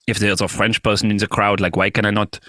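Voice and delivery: put-on French accent. Shown as a demonstration. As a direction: talk like a Frenchman speaking English. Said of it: "my singing when I'm in an accent".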